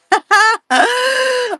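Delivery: bursting into a high giggle; a sudden high-pitched laugh at her own excuse. Real, not acted. Laughing to viewers about her packing excuse, high giggle.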